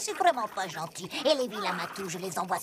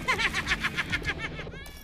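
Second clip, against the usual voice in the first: high, gleeful villain laugh; 5 semitones higher than usual. Acted. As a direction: laugh in triumph. Thief gloats leaving.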